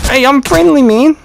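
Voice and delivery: loud friendly call, high and loud; shouting out. Real, not acted. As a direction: yell it out to a stranger. Calling out to another player.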